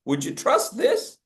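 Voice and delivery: ordinary talk, nothing marked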